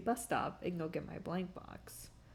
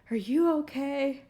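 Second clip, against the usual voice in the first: higher, louder mock-alarmed guard; worried guard; 6 semitones higher, 8 dB louder than usual. Retelling the security guard asking if she was okay, mock alarmed. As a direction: voice the startled guard rushing over.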